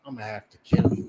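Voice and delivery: low, fast whispered threat; menacing whisper. Retold or imitated. Slave master quietly promising to kill, whispered. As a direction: hiss the death threat quietly. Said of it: "He said".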